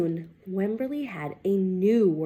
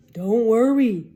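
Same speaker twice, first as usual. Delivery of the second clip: louder, firm reassuring dad; father; 9 dB louder than usual. Acted. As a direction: boom it out to calm her. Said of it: "said her father".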